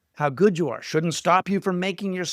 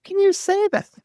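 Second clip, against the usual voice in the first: much higher, mock astonished squeak; incredulous disbelief. To a writer who boasts of gold, mock disbelief. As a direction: act amazed anyone could say that. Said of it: "And you go".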